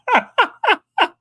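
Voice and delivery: high staccato cackle; laugh. Real, not acted. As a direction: burst out in a quick high laugh. Laughing at joke in super chat.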